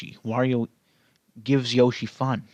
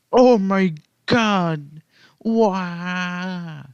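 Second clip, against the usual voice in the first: slow, loud, higher gasp of disbelief; amazed; 6 semitones higher, 4 dB louder, much slower than usual. Real, not acted. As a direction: drag it out in amazement. Amazed at own impression.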